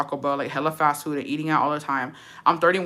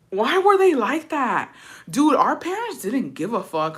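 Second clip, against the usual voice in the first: exasperated; 6 semitones higher, slower than usual. Real, not acted. Exasperated rhetorical question.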